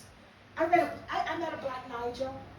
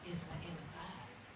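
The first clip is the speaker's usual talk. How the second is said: quiet confession; 6 semitones lower, 10 dB quieter than usual. Retold or imitated. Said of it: "She said".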